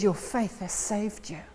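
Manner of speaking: softly and gently reassuring; greatest kindness. Acted. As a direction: speak it like a tender blessing. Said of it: "with the greatest of kindness".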